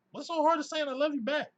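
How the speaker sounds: very high, loud incredulous voice; high-pitched, loud exasperation; exasperated question. Real, not acted. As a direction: squeak it out in disbelief. Exasperated question about saying it.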